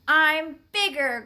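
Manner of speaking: loud, lightly growled boast. A narrator's reading. Dog boasts to a rival, growling. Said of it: "growled Ranger".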